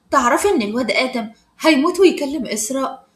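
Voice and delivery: ordinary delivery, nothing marked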